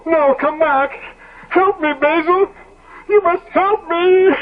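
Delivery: high, frantic begging; desperate pleading. Acted. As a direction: clutch at him as he leaves. Dorian begs Basil to stay.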